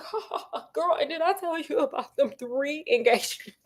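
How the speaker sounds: hammed-up laugh, then a self-pleased aside; smug laughing brag. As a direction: laugh at your own joke like a smug boyfriend. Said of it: "And he's like".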